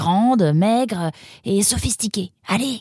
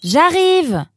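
loud, raised shout; calling out; 4 semitones higher, 5 dB louder than usual. Acted. Roxane shouting to the visitor at the door, calling out. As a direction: holler that it's on the way. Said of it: "cria Roxane".

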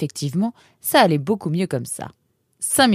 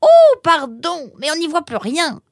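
high, loud drawn-out oh, then high protest; flustered apology; 12 semitones higher, 9 dB louder than usual. Acted. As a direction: apologise with a start for the blunder. Apologizes for treading on a paw.

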